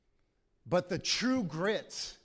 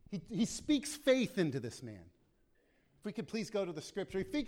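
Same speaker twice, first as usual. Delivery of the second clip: ordinary talk, nothing marked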